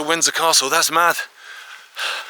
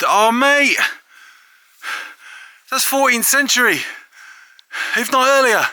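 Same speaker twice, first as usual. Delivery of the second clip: overjoyed, voice leaping up; buzzing with excitement; 8 semitones higher than usual. Real, not acted. Vlogger spotting the lost lime kiln at last: real, thrilled, high exclamations. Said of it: "I'm buzzing".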